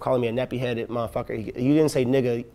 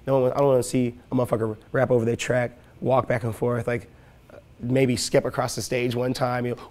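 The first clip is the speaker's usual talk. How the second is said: ordinary delivery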